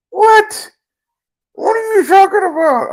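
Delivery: very high, loud incredulous squeal; hammed-up high-pitched bafflement; mock disbelief. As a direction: act out shock in a falsetto yelp. Acts out his shock on live show.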